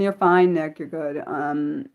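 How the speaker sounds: ordinary talk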